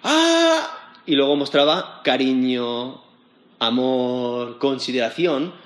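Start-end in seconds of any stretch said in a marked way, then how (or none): 0.0-1.0 high yelp with a laugh; mock cry of pain. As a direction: squeal as the father's beard is yanked. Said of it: "Y entonces el padre otra vez". (grito agudo de dolor fingido, riendo)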